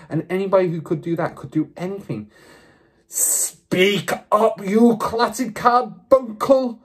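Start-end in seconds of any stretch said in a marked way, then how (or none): 3.1-6.8 slow, high, emphatic bellow; furious roar. A narrator's reading. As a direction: roar the insult, stretching each word. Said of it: "roared the Trunchbull".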